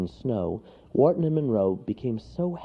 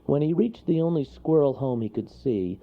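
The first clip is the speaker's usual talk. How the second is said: plain reading voice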